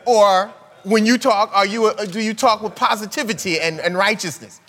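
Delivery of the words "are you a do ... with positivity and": ordinary talk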